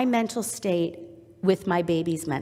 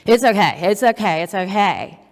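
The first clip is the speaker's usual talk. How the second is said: loud, perfunctory reassurance; tired new mom's curt, loud comforting; exhausted, unattuned soothing; 6 dB louder than usual. Acted. Exhausted mom to screaming baby at night, unattuned. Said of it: "I'm not gonna be very attuned".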